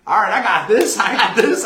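loud laughing reassurance; laughing eagerly. Retold or imitated. Speaker assuring Brandon he's got it, laughing loudly. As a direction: laugh through the promise.

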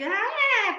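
a high, loud frightened shriek; retold terrified scream. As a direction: scream as if seeing something horrifying. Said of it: "lanza un grito así". (grito despavorido imitado)

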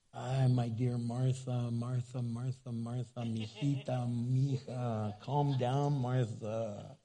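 Jesus gently calming Martha, soft and slow; tender, calming. Acted. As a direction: gently settle an upset friend.

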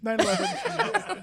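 high and laughing. Pretend. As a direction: squeak it out through laughter. Mock dramatic laughing repeat.

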